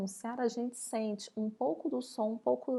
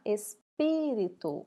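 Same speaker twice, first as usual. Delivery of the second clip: "espírito" with a short break, then loud PÍ; stressed syllable; 5 dB louder, much slower than usual. Shown as a demonstration. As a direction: hit the PÍ in "espírito". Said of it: "qual que nós falamos mais alto?". ("espírito" com o PÍ mais alto e forte)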